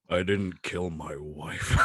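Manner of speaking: low, slow movie-star impression; Harrison Ford. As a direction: drag it out low as the actor. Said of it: "That's pretty good".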